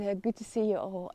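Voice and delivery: ordinary delivery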